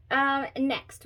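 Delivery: normal talking voice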